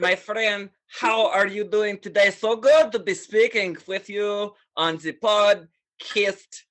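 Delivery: put-on Russian accent. Showing off accents to the podcast host, Russian accent. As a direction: greet your friend in a thick put-on Russian accent. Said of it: "I recall a Russian accent".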